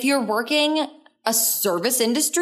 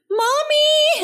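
put-on much higher, sing-song kid calling mommy; sweet child's voice; 3 dB louder than usual. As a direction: play an innocent child calling for mom. Voicing the kids' sweet call.

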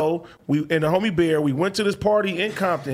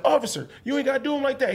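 quick, higher-pitched appeal; retold protest; 6 semitones higher, faster than usual. Protesting to the officer roughing up his homeboy, retold appeal. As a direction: plead fast on a friend's behalf.

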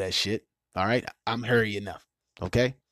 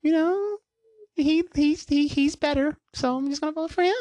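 much higher, dithering mock voice; mocking his cohost. Teasing the cohost about her voting, high mock dithering. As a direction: play her as vague and wishy-washy. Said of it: "she's just like".

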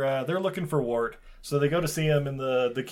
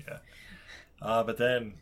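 normal talking voice

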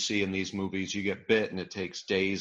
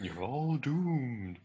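slow ominous raspy drawl; 3 semitones higher, 6 dB quieter, much slower than usual. Pretend. Echoing the doom prophecy.

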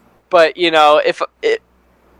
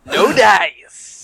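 cartoon voice, laughing through it; cartoon character line; Simpsons voice; 3 semitones higher than usual. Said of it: "You do a pretty good Simpsons voice".

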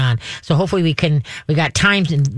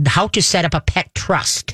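ordinary delivery

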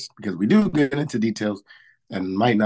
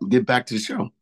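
normal talking voice

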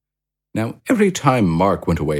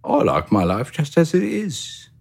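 much higher, content little hamster voice; Pip the hamster; 9 semitones higher than usual. A narrator's reading. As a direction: chirp it like a cosy, happy hamster. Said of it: "said Pip".

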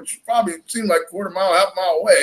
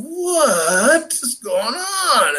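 disbelief; 7 semitones higher, much slower than usual. Retold or imitated. Finds partner waist deep in water.

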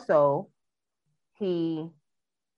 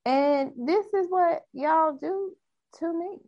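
exasperated; 11 semitones higher than usual. Real, not acted. Complaining to co-host.

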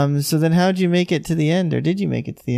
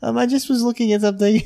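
higher-pitched mock "I'm fine" act; lightly teasing impersonation of someone refusing help; brushing off help; 7 semitones higher than usual. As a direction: imitate a friend waving away concern, pitched up. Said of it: "you don't ever wanna accept help".

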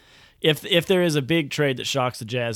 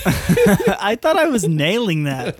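slow laughing protest, higher and louder; 7 semitones higher, 5 dB louder, slower than usual. Real, not acted. Cohost laughing after being stopped from a French accent, laughing. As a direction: laugh through the line, pleased with yourself. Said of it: "Will you do it in a French accent?".

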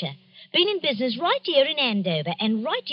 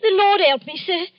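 gasping, louder, higher frightened cry; 7 semitones higher, 6 dB louder than usual. Acted. Shopkeeper to the Scotland Yard man, a frightened gasping cry. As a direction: gasp it out in fright.

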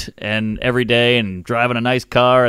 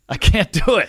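usual speaking voice

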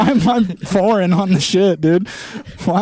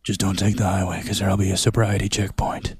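soft unvoiced whisper; 8 semitones lower, 6 dB quieter than usual. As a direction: whisper it like a secret. Whispered warning joke.

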